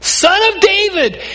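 high-pitched call across a crowd; shouting blind beggar. Retold or imitated. Blind man calling to Jesus over the crowd, shouting. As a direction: shout the title out to be heard. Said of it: "shouting at the top of his lungs".

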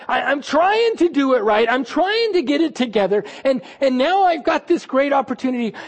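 usual speaking voice

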